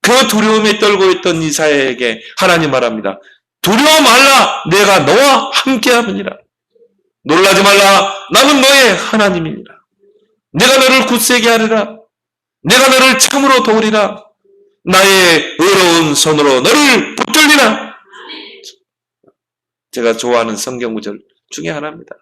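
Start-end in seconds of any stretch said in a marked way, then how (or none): 3.6-9.8 solemn scripture quote. Acted. Preacher reads God's words to Isaiah. 10.5-17.9 solemn scripture quote. Acted. Preacher reads God's promise.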